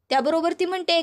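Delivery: usual speaking voice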